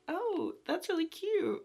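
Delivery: mock gushing; delighted. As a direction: coo over something adorable. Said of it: "I was like".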